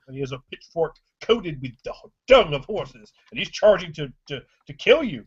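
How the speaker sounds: ordinary delivery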